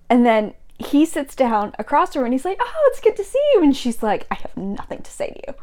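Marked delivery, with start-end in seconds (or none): 2.6-3.6 high-pitched, airy pleasant hello; cheery greeting. Acted. Acted cheerful greeting in retold scene. 4.3-5.5 very low-volume, curt mock snub; acted cold snub. Acted cold snub in retold scene.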